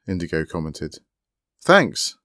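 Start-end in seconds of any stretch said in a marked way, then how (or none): 1.6-2.2 high, loud call with a falling tone. A narrator's reading. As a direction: call over from the other laptop. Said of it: "Lobelia called from the other laptop".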